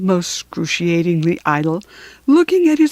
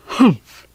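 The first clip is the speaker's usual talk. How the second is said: camel humph; 3 dB louder than usual. Acted.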